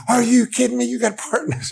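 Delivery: incredulous excitement. Retold or imitated. Roommate can't believe his buddy got a part, incredulous yell. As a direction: roommate freaking out at the news.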